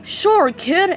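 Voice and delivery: breezy sales pitch; agent voice. A narrator's reading. Agent reassuring SpongeBob, breezy voice. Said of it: "answered the agent".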